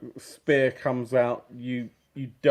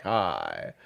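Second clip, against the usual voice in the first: beaming hello; 6 semitones higher, 5 dB louder than usual. Acted. Character beaming at the wary stranger, grinning hello. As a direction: say it with a big dopey grin. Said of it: "beam, sort of grin".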